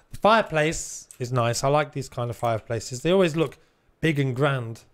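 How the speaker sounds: ordinary talk, nothing marked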